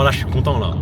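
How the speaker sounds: normal talking voice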